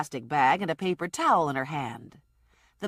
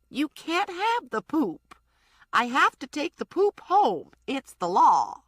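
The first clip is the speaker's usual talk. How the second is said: put-on higher, insistent old lady; stubborn old woman; 7 semitones higher than usual. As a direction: hold on to the poop, slow and firm.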